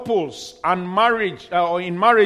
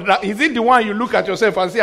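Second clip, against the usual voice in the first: ordinary delivery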